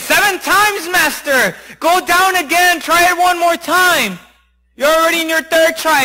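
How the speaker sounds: high, insistent coaxing; urging servant. Acted. Servant urging his master back under, high and insistent.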